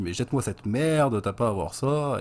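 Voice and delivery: hammed-up angry mother yelling at her son. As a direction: scold him with a loud, sharp yell. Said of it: "Elle engueule son fils".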